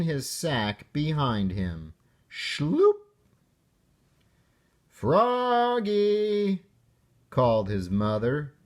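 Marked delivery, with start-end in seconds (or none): 2.3-3.0 high, rising cartoon "Schloop!"; sliding sound effect. Sack dragging sound. 4.9-6.6 high, drawn-out call of "Froggy"; mother calling out. Acted.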